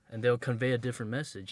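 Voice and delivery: ordinary talk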